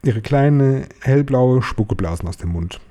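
ordinary delivery

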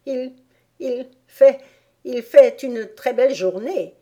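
high, hesitant, halting voice; timid stammer. A narrator's reading. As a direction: stammer shyly, restarting the phrase. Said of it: "murmura une voix timide".

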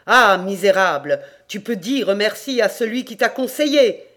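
loud, furious outcry; beside himself. A narrator's reading. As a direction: cry out in rage, voice climbing. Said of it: "hors de lui, s'écria".

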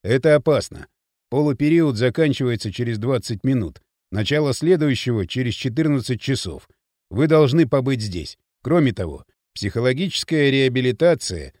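low-pitched, measured explainer; firm official Спартак. Acted. Спартак refusing Кирилл's demand, low and firm.

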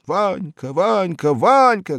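high, loud repeated calling out; loud desperate calls building up; yelling. A narrator's reading. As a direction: shout his name for all to hear. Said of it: "вопили ученические голоса".

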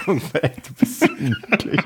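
high, giggling through the words; cracking up mid-sentence; laughing. Real, not acted. Breaks into laughter mid-intro.

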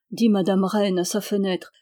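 ordinary delivery

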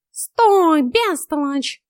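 high, loud cat voice; high-pitched scolding yell; shouted order. A narrator's reading. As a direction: shout her to a halt as a bossy cat. Cat shouts to stop her.

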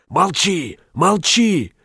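very high-pitched, urgent command; frantic silencing. A narrator's reading. Shopkeeper silencing his rival, frantic. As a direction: cut off the rival, pitch leaping up.

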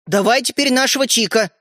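put-on mildly insistent boy's demand. A narrator's reading. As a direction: demand it, raising pitch a little. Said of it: "потребовал Родька". (требовательно)

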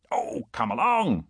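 drawn-out, coaxing urge; impatient urging. A narrator's reading. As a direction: hurry a dawdling friend along. Gibbon urges his reluctant friend on.

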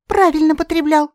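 high, loud approving voice; bright bird approving; approving firebird. A narrator's reading. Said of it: "одобрила Жаруся". (высокий звонкий одобрительный голос)

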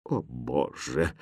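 slow, low, very soft sigh of a line; quiet dismay. A narrator's reading. Governess privately despairing at the princess, quietly. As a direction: groan it softly under your breath. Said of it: "так же тихо ответила".

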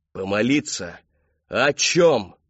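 loud demand rising in pitch; angry repetition. A narrator's reading. Father pressing his daughter, loud and angry.

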